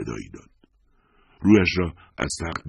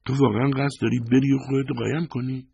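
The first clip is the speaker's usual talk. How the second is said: higher, lightly voiced wife questioning; voiced wife asking in disbelief; Lisa's voice; 11 semitones higher than usual. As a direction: lift the pitch for Lisa, incredulous. Lisa voiced in dialogue.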